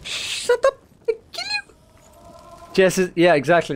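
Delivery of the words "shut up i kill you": much higher, squeaky mock threat at the enemy; hammed-up tiny warrior yelling; mock battle cry. Streamer threatening the monster in a silly high yell.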